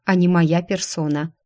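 plain reading voice

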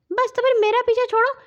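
high, loud, rapid scolding; angry axe. A narrator's reading. Talking axe tells children to leave it alone, angry high voice. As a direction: send them packing in a furious little voice. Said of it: "کلہاڑی غصے سے بولی".

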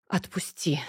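breathy, weary whisper; tired whisper. Acted. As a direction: sigh it out, worn out. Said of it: "устало вздохнула я".

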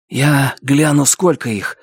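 usual speaking voice

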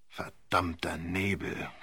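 growl. A narrator's reading. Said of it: "knurrte Sir Wilfrid".

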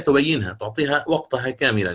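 usual speaking voice

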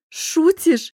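high, loud, chuckling disbelief; amused exclamation. A narrator's reading. Lilya laughs off her friend's doubt, amused. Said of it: "хохотнула Лиля".

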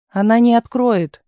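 calls out to her friend across the door; shouting. A narrator's reading. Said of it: "крикнула Галина".

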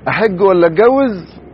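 high, loud and slow mock young man; imitating young man. Preacher mimicking a young man torn between pilgrimage and marriage, high and hammed-up. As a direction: ham up his dithering question. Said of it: "اللي قال لنا زمان".

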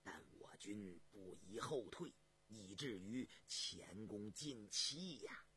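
low, soft, measured court official; deliberate low-voiced minister; minister advising the emperor. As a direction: counsel the throne slowly and gravely.

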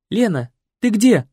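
high, loud anxious call; yelling. Acted. Екатерина yells for Лена in the dark. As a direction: yell out her name. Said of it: "завопила Екатерина".

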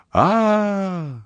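long very high scream. A narrator's reading. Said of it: "кричит Христинка".

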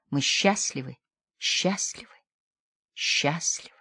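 тише с каждым повтором, до шёпота; повторяй как в бреду, угасая до шёпота; в бреду, затихая. A narrator's reading. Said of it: "Словно в бреду повторяла". (бредовый повтор, затихающий до шёпота)

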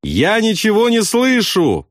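high, sustained cry at moderate volume; slow, high-pitched storybook shout. A narrator's reading. Bombardier with intercom unplugged, high drawn-out shout. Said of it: "крикнул он".

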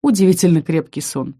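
ordinary delivery, nothing marked

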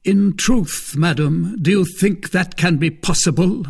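a smug, teasing brother-in-law; Lord de Winter. Acted. Baron mocking.

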